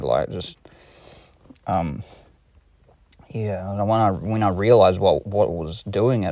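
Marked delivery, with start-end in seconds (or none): none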